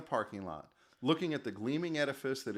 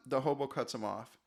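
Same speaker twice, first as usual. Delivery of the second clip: ordinary delivery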